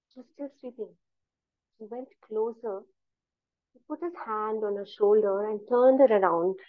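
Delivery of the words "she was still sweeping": plain reading voice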